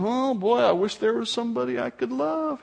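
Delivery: a lazy dreamer wishing for love; mock wistful sigh. Passive Paul sitting on his hands.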